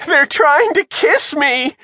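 very high, drawn-out panicky wail; panicked call. A narrator's reading. Shivers wriggles covered in clams, calling in panic. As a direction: call out slowly in squealing alarm. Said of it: "Shivers called".

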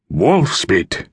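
ordinary delivery

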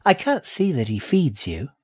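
high, sly rat voice; high-pitched, mildly needling. A narrator's reading. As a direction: poke slyly as a little rat. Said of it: "she said slyly".

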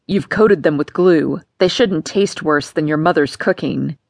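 mock her mother's cooking coldly; a father sneering at his stepdaughter; cruel father. Papa taunts Vera.

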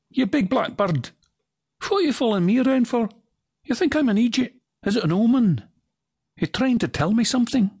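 high, fast, indignant Scots voice; a suspicious Scot scolding a raven; Scottish accent. Acted. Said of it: "signature sounds that you'll hear me".

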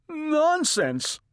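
high-pitched, unsure protest; frightened. A narrator's reading. Mister Watson dismissing his wife's fear, frightened. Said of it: "He sounded frightened".

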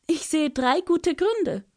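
high, coaxing little bird voice; Ara spricht weiter. Acted. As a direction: keep flattering in a high bird voice. Ara answers. (hohe, lockende Vogelstimme)

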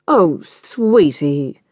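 very slow, drawn-out coo. Acted. Mother soothes her tearful daughter with a slow coo. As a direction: soothe her like a small child, a bit patronising.

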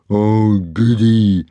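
slow, drawn-out gloating relish; happy brute. A narrator's reading. Bully about to whack Hiccup, happily gloating. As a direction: savour it slowly, as a pleased bully. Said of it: "said Dog's Breath happily".

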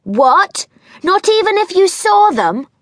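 high, loud astonished cry; cried out. A narrator's reading. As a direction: gasp it out in disbelief. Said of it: "cried Franny".

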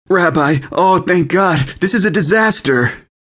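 high-pitched distressed yell. A narrator's reading. Frantic guest greets the rabbi amid disaster, yelling. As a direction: cry out in relieved panic, voice pitched high. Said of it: "turned around and yelled".

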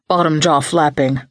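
usual speaking voice

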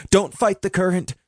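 high, urgent call over the water. Acted. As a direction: warn him from a distance. Shouting to drowning friend.

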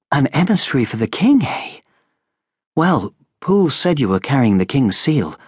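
gentle lady's hospitality, lightly voiced; lightly voiced Lady Gremil. A narrator's reading.

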